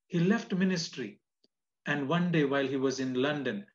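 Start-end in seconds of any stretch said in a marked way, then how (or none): 0.1-1.2 LEFT said higher and louder; emphatic repeat. Acted. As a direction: lean hard on left.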